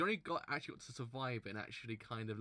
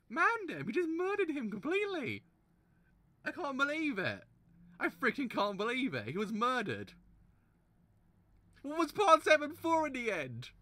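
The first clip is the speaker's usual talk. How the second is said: loud, high, exasperated ranting; real exasperated yelling; upset outburst; 12 semitones higher, 9 dB louder than usual. Real, not acted. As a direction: rant at the page in disbelief. Outraged at murder.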